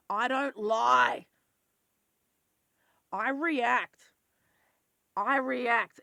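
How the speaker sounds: loud, slow, furious shout; sudden loud angry outburst. Real, not acted. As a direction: shout it out, offended and hurt. Angry outburst about lying.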